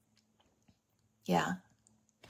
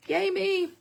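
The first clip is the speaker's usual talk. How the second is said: self cheer; 10 semitones higher than usual. Real, not acted. Self-congratulation.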